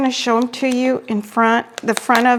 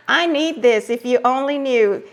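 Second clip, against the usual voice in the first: ordinary delivery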